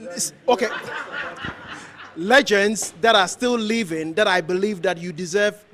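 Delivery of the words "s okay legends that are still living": laughing. Real, not acted. Laughs while correcting himself.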